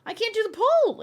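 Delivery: real exasperated cry; frustrated outburst. Real, not acted. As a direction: blurt it out in frustration. Frustrated streamer exclaims.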